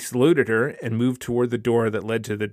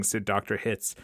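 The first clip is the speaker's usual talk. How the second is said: ordinary delivery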